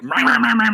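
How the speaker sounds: high mock ranting noise. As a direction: imitate someone talking over you. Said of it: "you just, like".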